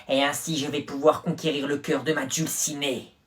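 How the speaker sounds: normal talking voice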